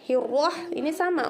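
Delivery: usual speaking voice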